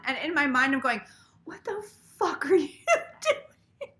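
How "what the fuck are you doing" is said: higher, slowed, cracking into laughter; laughing self-scolding. Retold or imitated. Her inner voice during the call, laughing. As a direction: scold yourself mid-giggle.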